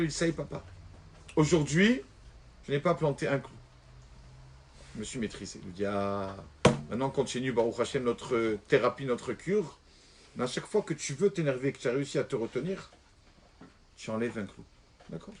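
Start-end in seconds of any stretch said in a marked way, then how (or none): 4.9-15.3 hushed storytelling. Acted. Father and son calm exchange.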